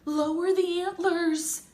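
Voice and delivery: high, loud command. A narrator's reading. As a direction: give the order loudly from the deck. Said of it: "Sylvia commanded".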